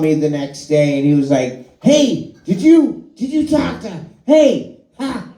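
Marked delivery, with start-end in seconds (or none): 1.8-5.3 very high, excitable Fallon voice with stammering starts; squeaky, breathless Fallon, tripping over his words; Jimmy Fallon. Retold or imitated. Comic telling [name] story, doing Fallon on the phone, high and excited. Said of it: "I'm trying to do a Jimmy Fallon impression".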